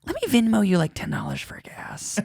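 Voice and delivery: whispered pity. Retold or imitated. Pitying offer of gas money.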